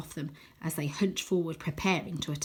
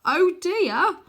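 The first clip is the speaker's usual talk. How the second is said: dismayed; 11 semitones higher, 7 dB louder than usual. A narrator's reading. Repeated in dread.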